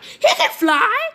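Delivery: high, loud, drawn-out exclamation; amazed onlooker. Retold or imitated. Crowd watching Menma fly up in the sky, amazed. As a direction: cry it out in slow, high-pitched astonishment. Crowd astonished in retold story.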